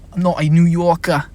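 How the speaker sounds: playful attempt at a New Yorker; mock New York accent. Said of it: "never gonna do those voices".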